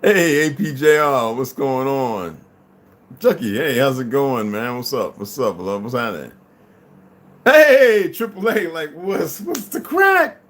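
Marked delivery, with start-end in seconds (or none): 0.0-2.4 drawn-out greeting. Real, not acted. 7.4-10.4 excited greeting. Real, not acted.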